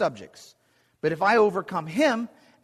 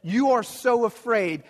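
higher, louder, slow mocking taunt; taunting shout; 6 semitones higher, 4 dB louder than usual. Giant Goliath taunting the Israelite army, slow loud jeer. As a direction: bellow it across the valley. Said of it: "shouts in defiance of the other army".

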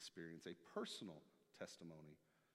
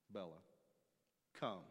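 curt dog command; 4 dB louder than usual. Shown as a demonstration. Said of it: "what tone of voice do you hear".

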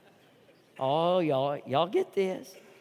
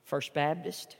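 much softer and lower; hushed sermon close; 9 semitones lower, 10 dB quieter than usual. Acted. Pastor closing to First Baptist, hushed. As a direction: bring it down to a whisper of sincerity.

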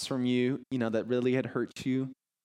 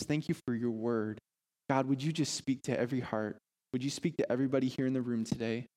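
prayer. Acted. Pastor leads the congregation in prayer.

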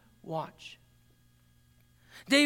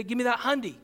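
slangy demand; faster than usual. Retold or imitated. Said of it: "as they say today".

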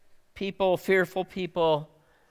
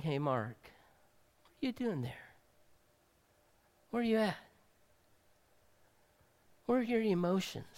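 softer, hushed, tender calling; gentle quiet voice of God; 2 semitones higher, 10 dB quieter, faster than usual. Acted. God voiced speaking to him.

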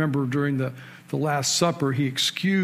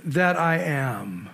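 the voice from the burning bush naming itself, solemn; voice of God; much slower than usual. Acted. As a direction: let the last words stretch out slowly. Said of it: "And he said".

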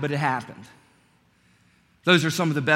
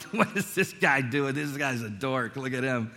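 mocking; 3 semitones lower, 5 dB quieter than usual. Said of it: "they're not laughing with him".

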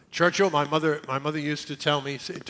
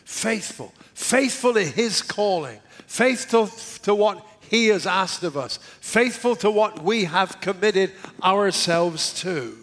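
Faithful punched high at each start; stress on faithful; 4 semitones higher, 3 dB louder, slower than usual. Real, not acted. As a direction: hit Faithful every time. Repeated building emphasis on faithful.

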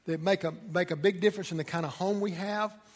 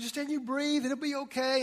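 mock reassuring husband; 7 semitones higher than usual. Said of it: "And I'm saying".

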